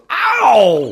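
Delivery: loud, high comic yelp; mock cry of pain. Apple bitten by Eve, loud hammed-up yelp.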